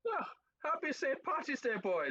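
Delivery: put-on high Irish accent greeting; cheery Irish lilt. Irish bit for holiday.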